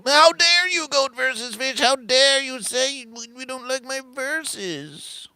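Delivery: very high, sustained indignant wail; hammed-up outrage. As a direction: wail it on one high pitch, slowing down. Rejected creator acts out outrage.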